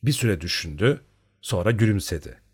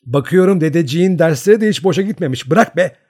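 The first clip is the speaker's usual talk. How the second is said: loud, high protest; a girl wriggling out of a hug, calling out; struggling free; 8 semitones higher, 9 dB louder than usual. A narrator's reading. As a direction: push her off with a loud high cry. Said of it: "Maryanka kurtulmaya çalışarak".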